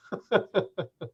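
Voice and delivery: chuckling in a short run; laugh. Real, not acted. Process server laughing off the whole game, chuckle. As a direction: laugh it off lightly.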